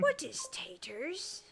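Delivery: raspy whispered creature question; Smeagol. Acted. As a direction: whisper it slowly, like a puzzled creature. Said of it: "Smeagol for the win".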